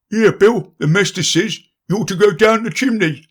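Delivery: high voices passing the blame; squabbling animals. Acted. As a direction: argue over who goes down. Animals arguing over the chimney.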